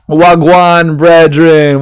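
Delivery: loud, hammed-up Jamaican greeting; terrible Jamaican accent. Greeting a viewer in a bad Jamaican accent. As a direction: throw out a mock Jamaican hello. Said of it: "my terrible Jamaican accent".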